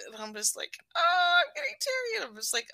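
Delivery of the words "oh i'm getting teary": very high, self-mocking whimper; mock teary wail. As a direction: play up getting weepy in a tiny high voice. Mocking own teariness.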